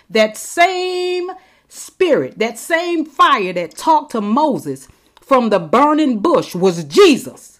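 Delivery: rising fervour, drawn out; excited preaching. Real, not acted. Preacher caught up in revelation, excited fervour. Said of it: "Oh, I get excited".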